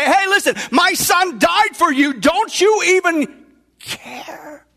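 indignant outcry. Acted. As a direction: hammer each word at the uncaring crowd. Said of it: "jump up and down ... and say".

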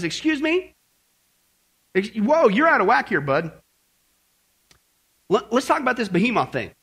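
a hint of an annoyed father; mildly indignant God. God telling Job he is out of line, mildly indignant.

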